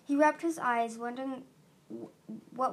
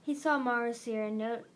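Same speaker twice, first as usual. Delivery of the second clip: ordinary delivery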